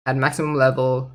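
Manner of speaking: ordinary delivery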